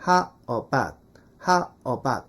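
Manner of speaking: "How about" as "ha uh-bat", both glides dropped; low, measured "ha... uh-bat" for "How about"; combined reduced vowels. Shown as a demonstration. Teacher joining the two words, both glides dropped. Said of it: "앞에 거하고 합쳐".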